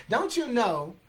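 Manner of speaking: loud, high, breathless tumble of words; poem recital. Acted. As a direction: rattle out the tongue-twisting verse emphatically, picking up speed. Recites poem fast, full force.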